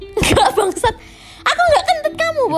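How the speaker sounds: high, loud indignant yell; outraged shout. Real, not acted. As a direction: shout the denial, half laughing. Denies farting, shouting back.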